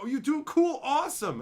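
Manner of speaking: his own naive delight at a compliment; naive happy puppy. Retold or imitated. Imitating his naive happy self.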